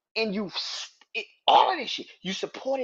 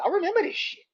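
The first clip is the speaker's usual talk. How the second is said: mocking high; 14 semitones higher, 4 dB louder, faster than usual. Real, not acted. Mocking recalled fan claim.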